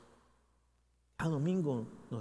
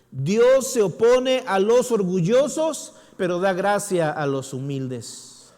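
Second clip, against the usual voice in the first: booming scripture quote; 6 semitones higher, 10 dB louder, slower than usual. Shown as a demonstration. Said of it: "repeats verse quietly after".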